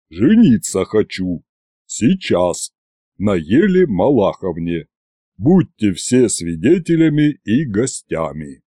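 low bass, short halting phrases; Иван. A narrator's reading. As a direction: blurt out the announcement in a deep voice. Иван басом объявляет о женитьбе. (низкий бас с паузами)

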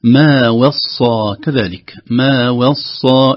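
slow chant, "وصى" opening on a thin "we"; light wāw. Shown as a demonstration. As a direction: keep و in "وصى" light before heavy ص. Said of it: "يحذر من تأثر الواو بحرف الصاد". («وصى» بترقيق الواو ببطء)

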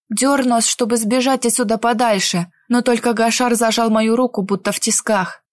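usual speaking voice

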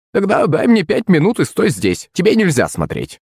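high crone voice, instructing; bossy old woman. A narrator's reading. Witch telling him to wait and not look, bossy.

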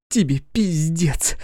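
high, slow venomous hiss; angry hiss. Acted. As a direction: spit the threat out. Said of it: "злобно прошипела Кристина".

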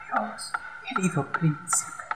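weak, breathless high whisper; faltering whisper. A narrator's reading. Dying swallow whispering goodbye to the prince. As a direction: stammer it out, dying. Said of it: "balbuceó".

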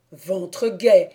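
loud angry oath; exclaiming oath. Acted. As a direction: cry it out. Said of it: "s'écria-t-il".

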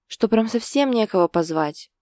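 usual speaking voice

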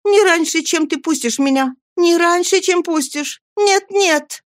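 high, frantic female refusal; high-pitched agitated cries; crying out. A narrator's reading. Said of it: "вскричала Нанси".

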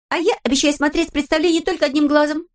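put-on high, lively girl's voice; Pippi voice. A narrator's reading. Narrator voicing Pippi, high and perky. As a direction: play Pippi as a cheeky girl.

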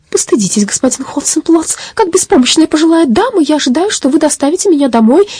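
put-on high, pleading old lady's voice; plaintive granny. A narrator's reading. Granny pleading with the robber to take her home. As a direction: plead pitifully after a few sobs. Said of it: "жалобным голосом сказала".

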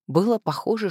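usual speaking voice

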